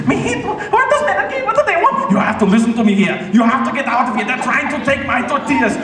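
high, frantic accented action-hero impression; hammed-up Austrian-accented alarm; Arnold Schwarzenegger. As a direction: rush it out like a panicked action star. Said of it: "Arnold Schwarzenegger".

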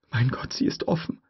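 whispered, breathless; horrified whisper. A narrator's reading. Tim realising the door opened, horrified whisper. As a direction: gasp it out in horror.

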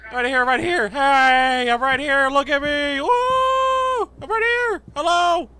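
high, loud hollering to get noticed; whooping, high-pitched yell across a distance; frantic calling out. Pretend. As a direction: shout excitedly for someone's attention. Calling for attention to tower.

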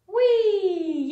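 long, loud whee sliding down; high playful squeal of "whee"; gleeful whee. Pretend. Teacher drawing a line for kids, gleeful whee.

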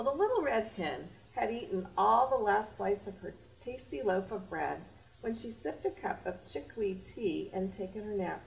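plain reading voice

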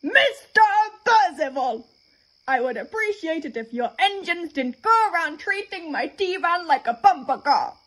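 high, loud indignant scolding; angry. A narrator's reading. Refreshment lady scolding the controller about her van, angry. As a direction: tell him off sharply. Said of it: "She finished angrily".

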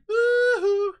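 high whooping shout; excited cheer. Real, not acted. Gamer cheering a chance on screen with a whoop. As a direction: let out a big woo-hoo.

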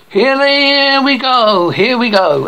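a hint of a boxing announcer; male announcer. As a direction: draw out the call like a ring announcer. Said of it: "And male announcer".

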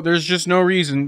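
usual speaking voice

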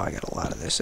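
breathy whisper to yourself; whispered aside. Real, not acted. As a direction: mutter it under your breath. Muttering to self.

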